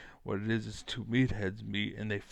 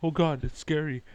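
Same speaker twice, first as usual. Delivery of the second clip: mock scared; 5 semitones higher, 5 dB louder than usual. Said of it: "the betas are like".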